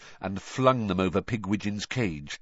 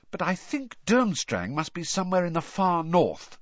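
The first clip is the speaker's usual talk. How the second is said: higher, musing voice; thoughtful; 10 semitones higher than usual. A narrator's reading. Girl guessing where school is, thoughtful. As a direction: reason it out aloud. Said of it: "said Hermione thoughtfully".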